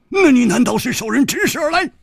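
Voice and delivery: high, loud rapid demand; sharp interrogation. Acted. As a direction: press the question forcefully. Interrogator presses cornered man.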